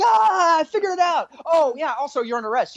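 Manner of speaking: high sing-out of triumph, dropping off; celebrating. Retold or imitated. Cop gloating then announcing the arrest, celebrating. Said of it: "like, celebrating".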